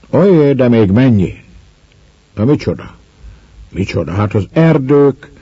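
a hint of a proud wife listing her treasures; lightly voiced proud peasant woman. A narrator's reading. Woman proudly lists her riches. (enyhén megformált büszke asszony)

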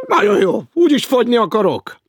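high, loud sarcastic retort; loud sarcasm. Acted. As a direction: throw the punishment back with mock cheer. Hecker sarcastic about soup diet.

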